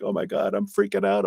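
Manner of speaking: high, hammed-up panic; playful fake freak-out; mock panic. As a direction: squeal it like a scared kid at a clown mask. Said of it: "if it's a scary clown mask".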